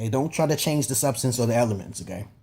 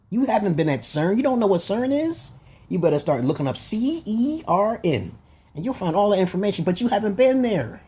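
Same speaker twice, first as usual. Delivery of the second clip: heated rant; 6 semitones higher than usual. Real, not acted. Ranting at viewers about cern.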